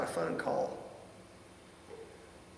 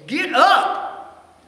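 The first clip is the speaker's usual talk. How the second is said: sharp high yell, much louder; imagined angry shout; 11 semitones higher, 10 dB louder than usual. Retold or imitated. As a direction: bark "Get up!" at someone stuck in bed. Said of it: "grab her by the neck and say".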